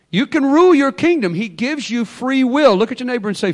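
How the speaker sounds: ordinary talk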